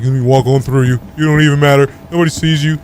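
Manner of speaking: mock dismissive. Acts out rude passer-by's attitude.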